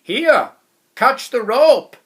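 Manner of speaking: loud call from high above; shout. A narrator's reading. Someone up high shouting down to the narrator, loud call. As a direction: yell it down to someone stuck below. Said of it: "someone up high shouted".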